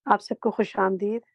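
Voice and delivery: ordinary talk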